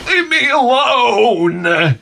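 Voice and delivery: slow, drawn-out, pitiful moan; sick moan. Retold or imitated. Sick brother moaning at his teasing sisters, pitiful. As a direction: groan it like you're miserable.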